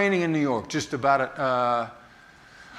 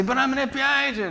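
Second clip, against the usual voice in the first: much higher, a lightly acted protest; mock pleading; 11 semitones higher, faster than usual. Telling the guards he is an agent, high mock protest. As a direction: plead your case up high. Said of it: "And I'll say".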